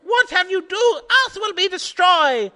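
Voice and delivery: very high, loud alarm; panicked cry. Acted. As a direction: wail that Earth is doomed. Megatron panics.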